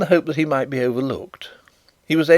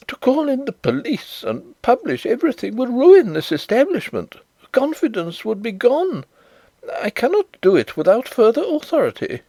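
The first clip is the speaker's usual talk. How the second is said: higher, fretful manager voice; lightly raised manager voice; anxious bank manager; 11 semitones higher than usual. Acted. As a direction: plead to protect the firm.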